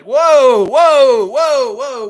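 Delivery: loud, high startled whoas; alarmed whoa. Pretend. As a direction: pull up short, stunned. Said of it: "Peter would be like".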